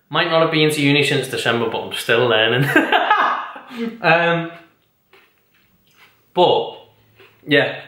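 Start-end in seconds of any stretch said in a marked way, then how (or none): 2.7-4.0 high laugh. Real, not acted. Laughs at her own joke.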